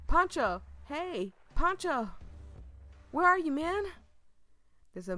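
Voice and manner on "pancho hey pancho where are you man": loud, high call for a lost friend. Pretend. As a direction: shout across the manor for Pancho. Calling out for friend.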